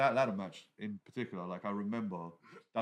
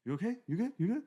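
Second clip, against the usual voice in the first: higher-pitched quick questions, rising at the end; raised pitch, upturned little questions; imitated checking-in; 13 semitones higher, 4 dB louder than usual. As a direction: mimic someone repeatedly checking on you. Imitating others checking on him.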